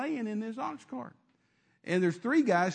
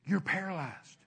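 devil whispering; slower than usual. Retold or imitated. Devil on the shoulder murmuring lies, lightly voiced. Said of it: "the devil sitting on his shoulder saying".

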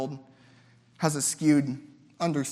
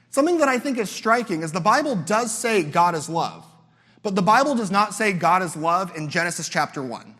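ordinary talk

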